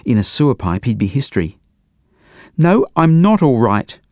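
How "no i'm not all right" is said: high, louder, slow indignant yell; child's yell. A narrator's reading. Leo yelling back to his brother from the toilet, high slow yell. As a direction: wail it back through the door. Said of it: "yelled Leo".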